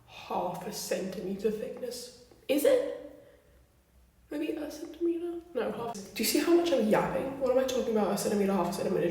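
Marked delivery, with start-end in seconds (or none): 2.5-5.4 sudden self-doubt. Real, not acted. Doubting her own measurement.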